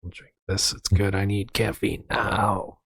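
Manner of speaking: soft, grudging self-talk; quietly talking yourself into it; resigned mutter. Retold or imitated. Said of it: "I'm like".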